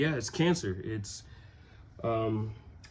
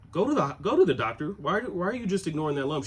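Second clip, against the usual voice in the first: a higher, fast, insistent mother urging her son; imitating mom, higher and quick; nagging mom; 8 semitones higher, 7 dB louder, faster than usual. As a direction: rattle it off like a worried mom pushing him to the doctor. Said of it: "my mom is like".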